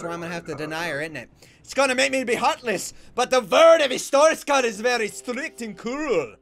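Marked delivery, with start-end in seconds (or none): 1.6-6.4 put-on loud, higher Slavic-accented proclamation; stern border guard with a put-on Slavic accent; mock Eastern European accent. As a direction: declaim it as a hammy Eastern European official. Playing stern inspector, mock accent.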